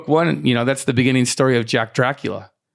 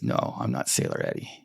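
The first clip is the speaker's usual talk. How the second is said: much lower and softer, deadpan; low flat denial; 11 dB quieter than usual. Retold or imitated. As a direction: mutter the denial low and quiet. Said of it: "And Eddie says".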